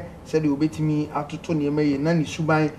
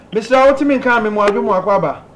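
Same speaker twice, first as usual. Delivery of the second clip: louder and higher, calling down a bad phone line; 5 semitones higher, 6 dB louder, faster than usual. Real, not acted. Host calling out to a caller on a bad line, loud. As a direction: raise the voice so the caller can hear.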